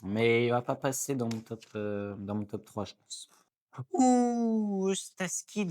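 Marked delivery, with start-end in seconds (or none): 3.9-5.7 excited ouh. Real, not acted. Sees Eustace Kid card.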